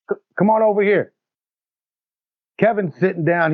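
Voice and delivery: ordinary talk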